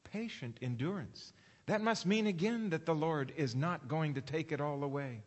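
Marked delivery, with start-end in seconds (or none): none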